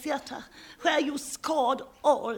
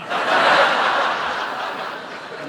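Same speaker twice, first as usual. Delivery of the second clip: loud laugh; 5 dB louder than usual. Acted. Laughs after her quip.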